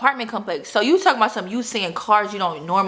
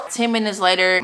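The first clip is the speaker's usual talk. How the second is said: ordinary delivery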